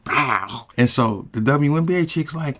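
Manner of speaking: ordinary talk, nothing marked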